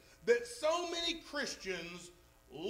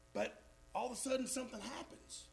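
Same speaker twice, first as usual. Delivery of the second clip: much softer and fast, hushed turn; dramatic hush; 12 dB quieter, faster than usual. Real, not acted. Preacher tells the church how joy is lost, suddenly hushed. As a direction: drop right down as if revealing trouble.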